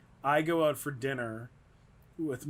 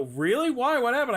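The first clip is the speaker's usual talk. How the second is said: eager reporter; 9 semitones higher, 7 dB louder than usual. Retold or imitated. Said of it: "And they go".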